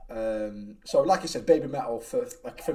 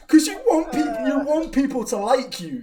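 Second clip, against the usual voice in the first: ordinary talk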